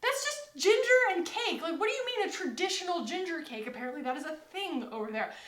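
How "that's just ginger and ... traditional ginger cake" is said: baffled disbelief. Retold or imitated. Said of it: "I'm like".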